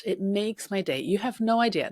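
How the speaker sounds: ordinary talk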